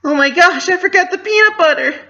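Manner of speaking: high, loud, fast squeal of dismay; panicked realisation. Acted. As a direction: shriek it as the mistake hits home. Pinky realises her mistake.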